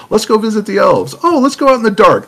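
high, loud, mock-eager voice; naive adventurer. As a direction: propose it like a clueless, excited tourist. Acts out naive visitors to elves.

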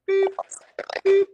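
two short beep sounds; imitated beeping. Store alarm going off, imitated beeps.

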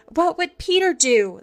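high, urgent question; crying out. A narrator's reading. The boys think of Peter in danger, crying out. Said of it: "they cried simultaneously".

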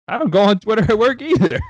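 loud, high-pitched defensive squeal; indignant protest. Real, not acted. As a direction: protest your innocence way up high, loudly. Defending himself against partner's teasing.

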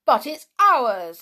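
indignant cry. A narrator's reading. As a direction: object with wounded outrage. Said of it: "cried Peter indignantly".

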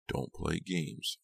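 soft, slow, emotionless menace; without emotion. A narrator's reading. Farberson warning Jade, without any emotion. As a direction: say it slowly and quietly, giving nothing away. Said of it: "replied without any emotion".

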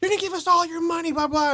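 high-pitched imitation of angry yelling; mock yelling. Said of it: "they were all yelling".

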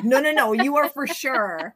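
hammed-up insistent disbelief; mock insistence. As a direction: imitate people who flatly refuse to believe you. Acts out people insisting.

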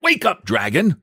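high, sharp wake-up call; sharp command. A narrator's reading. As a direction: rouse a sleeper sharply. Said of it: "he said sharply".

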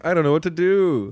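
lightly hammed-up, high-pitched helpless plea; mock helpless owner. Joking about a busy restaurant owner, in a high mock-helpless voice. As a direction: play at being an overwhelmed restaurant owner.

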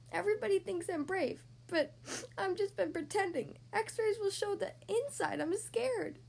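a scared little turtle admits the truth through tears; tearful confession. A narrator's reading. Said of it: "but then he started to cry".

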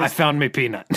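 silly put-on pirate quote; comic film-pirate impression; Jack Sparrow. Co-host quoting the only line remembered, mock pirate voice. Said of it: "the only quote I can think of is".

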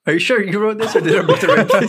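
fast, laughing tease; giggling through a quick jab. Real, not acted. Teasing while laughing.

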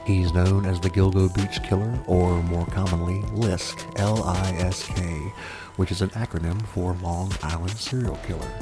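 spooky fireside storyteller; horror host. Acted. ASMR murder podcast host intro.

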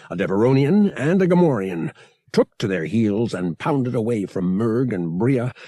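plain reading voice

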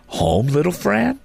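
put-on high, wondering boy's question; hopeful child, rising in disbelief; young boy. Boy answers his little sister.

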